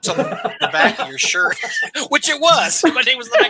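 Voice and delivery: laughing through the words; real laughter over his own blunder. Real, not acted.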